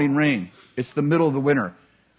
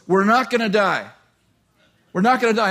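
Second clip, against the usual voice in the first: lightly voiced firm older man, raised pitch; insist, as if to a panicking young man; 7 semitones higher, 4 dB louder than usual. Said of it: "an older man".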